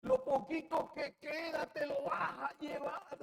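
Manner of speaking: high desperate shout at God; anguished shout. Acted. Ezekiel cries to God over the dying remnant, desperate high shout. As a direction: scream it out in despair. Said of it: "gritó".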